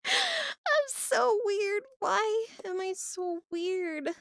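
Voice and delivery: high, drawn-out mock wail; long, high-pitched comic whine; playful self-lament. Real, not acted. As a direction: wail slowly at your own silliness. Playful wail about own weirdness.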